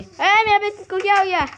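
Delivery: high, loud what happened to my bed; shocked outcry. Real, not acted. Player shocked to find his bed broken, high loud outcry. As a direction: yelp in disbelief.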